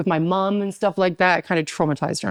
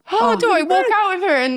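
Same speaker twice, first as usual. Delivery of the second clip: high-pitched mock panic; retold high flustered outburst; 12 semitones higher than usual. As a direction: re-enact being overwhelmed, voice jumping up. Retold panic at keeping secret.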